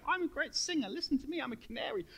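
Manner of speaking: high, vain show-off singer voice; mock boasting. Mocking a conceited singer, boastful and high. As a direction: preen like a proud canary. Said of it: "He loves you too much to go".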